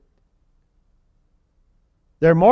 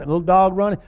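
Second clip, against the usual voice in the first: ordinary delivery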